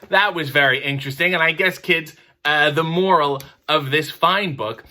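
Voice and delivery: ordinary delivery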